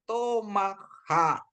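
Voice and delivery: Tomaha drawn out slowly, syllable by syllable; teacher sounds out Tomaha slowly for the class; slow demo reading. Shown as a demonstration. Teacher models the word slowly for students. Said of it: "kata kedua".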